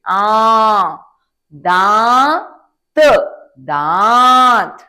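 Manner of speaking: दांत stretched very slowly and loud, nasal vowel held; loud nasal demo. Shown as a demonstration. Teacher modelling दांत loudly for children to repeat. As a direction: call out दांत long through nose and mouth. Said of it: "जोर से बोलिए, नाक से भी और मुंह से भी".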